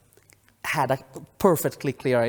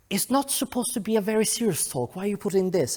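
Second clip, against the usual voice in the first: higher, lightly mock-scolding husband voice; imitating husband; 7 semitones higher, faster than usual. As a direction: play the husband, gently telling him off. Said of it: "my husband was very much like".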